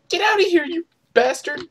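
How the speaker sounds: panicked high-pitched shout; shrieking yell. Real, not acted. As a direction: shriek it at the attacker. Shrieks at seeker.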